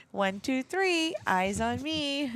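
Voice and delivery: sing-song classroom call, slow; kindergarten teacher voice. Retold or imitated. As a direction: chant it like calling a class to attention. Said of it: "being a teacher".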